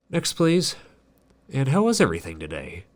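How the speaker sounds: high, bright-voiced cashier greeting; cheery cashier. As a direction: chirp it like a peppy cashier.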